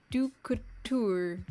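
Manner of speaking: "Ducouture" said slowly, syllable by syllable, French-style; French name attempt. Shown as a demonstration. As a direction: sound out "Ducouture" carefully, trying for French. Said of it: "Not English, obviously".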